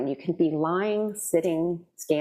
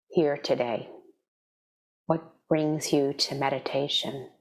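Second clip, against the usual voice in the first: guided meditation. Real, not acted. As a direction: ease them gently into stillness, leaving space between phrases.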